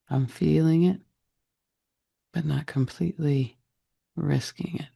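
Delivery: normal talking voice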